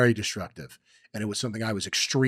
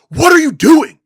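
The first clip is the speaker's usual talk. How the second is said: much higher and louder, furious shout; retold angry yell; 16 dB louder than usual. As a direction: yell at your wife in the rain. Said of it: "And I was like".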